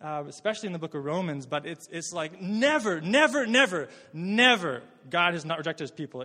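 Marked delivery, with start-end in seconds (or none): 2.4-6.2 building insistence, then a quick release; emphatic never. Retold or imitated. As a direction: hammer the word never. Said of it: "as emphatically as he can".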